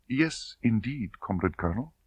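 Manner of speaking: soft, submissive reply; meek. A narrator's reading. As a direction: answer meekly. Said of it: "Tatiana submissive".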